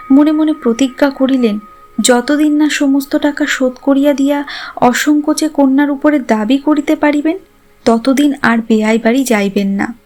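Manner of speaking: ordinary delivery, nothing marked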